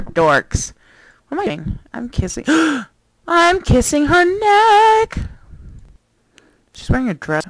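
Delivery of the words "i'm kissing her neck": gasp, then higher and slow; shocked gasp. Real, not acted. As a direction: act scandalised at yourself. Shocked at her own action.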